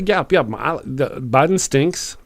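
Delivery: usual speaking voice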